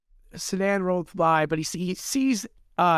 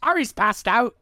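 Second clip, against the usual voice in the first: higher, mock British-ish accent; weird accent; 7 semitones higher, 4 dB louder than usual. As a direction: mimic the weird accent back, pitched up. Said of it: "weird accent".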